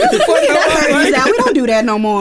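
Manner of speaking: laughing. Real, not acted. Laughing while teasing friend.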